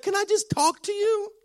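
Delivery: very high imitation of a wife; pleading wife. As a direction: mimic her asking for attention in a tiny high voice. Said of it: "she's like".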